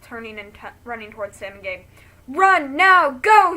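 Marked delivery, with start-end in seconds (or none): 2.3-3.5 loud, high urgent scream. Acted. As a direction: scream at them to run now. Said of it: "he screams".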